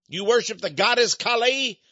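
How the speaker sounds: usual speaking voice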